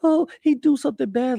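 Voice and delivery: high-pitched mocking complaint; mock victim. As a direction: imitate someone playing the victim. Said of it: "they are victims when they don't supposed to be".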